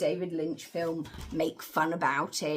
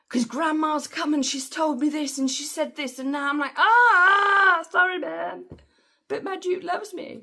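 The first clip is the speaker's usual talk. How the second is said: much higher, loud flustered voice with a yelped ah; flustered Jessica; 11 semitones higher, 9 dB louder than usual. Retold or imitated. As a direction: play a daughter caught out, whining back at her mother. Jessica voiced defending herself.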